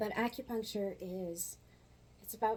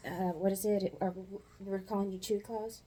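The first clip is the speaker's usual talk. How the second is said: ordinary delivery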